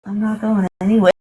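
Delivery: usual speaking voice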